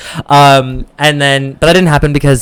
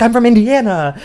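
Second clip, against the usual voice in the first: high but softer mock squeal; imitated excitement; 8 semitones higher, 5 dB quieter than usual. Bar stranger sharing her home state, imitated excitement. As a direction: imitate gushing at a shared connection, high. Woman thrilled at shared Midwest roots.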